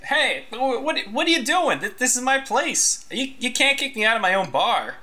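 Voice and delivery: high, outraged barkeep protesting; indignant bartender. Acted. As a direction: squeak out his protest as the wronged owner. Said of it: "and he's saying".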